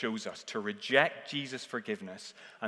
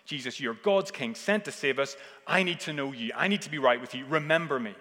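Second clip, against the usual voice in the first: loud, raised plea to his king; dying criminal begging Jesus, loud; pleading criminal; 3 semitones higher, 7 dB louder than usual. Acted. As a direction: beg to be known before dying.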